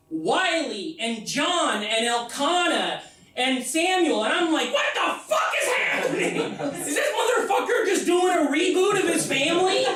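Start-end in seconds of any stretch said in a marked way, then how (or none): 4.7-9.8 high, shouted disbelief; a shocked shriek that turns into laughter; outraged yell. Retold or imitated. Outraged discovery about ancestor.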